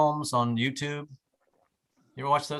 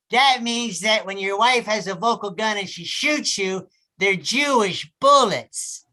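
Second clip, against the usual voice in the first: slow, slurred, higher; slurring alcoholic therapist; 6 semitones higher, 3 dB louder, slower than usual. Retold or imitated. Therapist explaining his wife to him, slow slurred tipsy voice. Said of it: "sounded like an alcoholic".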